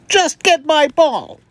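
loud, high, emphatic demand; acted high-pitched outburst, each word hit hard; snapping princess. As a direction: order it like an impatient princess. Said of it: "the princess snapped".

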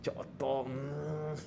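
soft, hesitant "choto" trailing off into a hum. Shown as a demonstration. Teacher shows how Japanese speakers hesitate with "choto", soft and trailing. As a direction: show the hesitation, let "choto" trail away. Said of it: "tiene que hacer este como".

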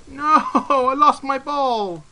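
loud, high, drawn-out lament; slow playful cry of dismay; mock wail. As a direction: wail over a lost toy, hammed up. Mock grief over lost ball.

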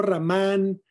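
"Ramán" as American ruh-MAN; anglicized pronunciation. Shown as a demonstration. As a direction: say "Ramán" with a weak first vowel and English r. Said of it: "no Ramán".

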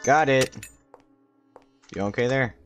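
normal talking voice

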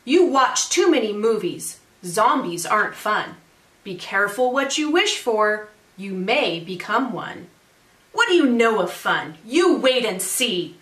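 lively, singsong storytelling; picture-book read-aloud. A narrator's reading. Teacher reads a zombie picture book to families, animated read-aloud. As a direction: read it to children with rhythmic emphasis.

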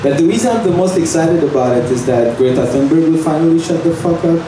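ordinary delivery

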